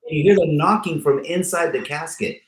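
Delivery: ordinary talk, nothing marked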